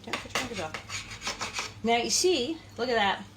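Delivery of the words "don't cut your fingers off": softer, slow whispered "don't cut your fingers off"; whispered warning. Shown as a demonstration. Reminding viewers about knives, slow whispered warning. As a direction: hush it like a spooky reminder. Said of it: "I'm always reminding you".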